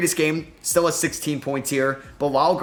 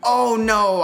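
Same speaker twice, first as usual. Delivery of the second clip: dismayed cry; 9 semitones higher, 5 dB louder than usual. Real, not acted. Missed shot for the lead.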